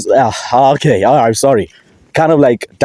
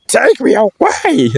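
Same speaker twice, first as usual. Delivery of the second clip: high, slow swooning voice; swooning girl; 13 semitones higher, slower than usual. Pretend. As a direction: melt like a smitten girl. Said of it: "If I was a girl".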